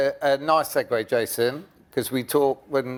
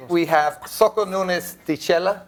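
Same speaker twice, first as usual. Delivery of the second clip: put-on slow, light Italian accent on the name; lightly put-on Italian accent; half Italian accent; 4 semitones higher than usual. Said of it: "I'm putting my half Italian accent on".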